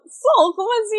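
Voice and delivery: high, startled "como assim"; startled reply. Retold or imitated. Artist startled when asked if she's from Pará, high surprised reply. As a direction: jump up in pitch, caught off guard. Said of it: "E ela levou um susto".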